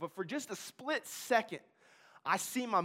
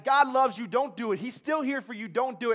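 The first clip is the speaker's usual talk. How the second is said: louder, higher pleading shout; screaming angel; 4 semitones higher, 7 dB louder than usual. Retold or imitated. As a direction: plead hard, shouting encouragement. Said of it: "who's screaming at me like".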